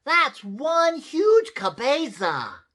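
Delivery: very high, slow, loud cry; amazed exclamation. A narrator's reading. Skippy John checks his head in the mirror, exclaiming. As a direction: exclaim it big and drawn out. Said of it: "exclaimed Skippy John Jones".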